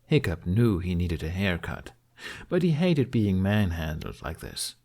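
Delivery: plain reading voice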